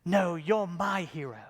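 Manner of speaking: slow, put-on British accent; mock-grand British delivery. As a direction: imitate a British gentleman's accent, drawn out. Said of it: "in that wonderful British accent".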